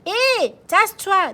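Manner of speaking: high loud baker telling him to move over; baker snapping. Acted. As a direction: shove the words at him. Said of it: "dit la boulangère". (la boulangère, aiguë et forte)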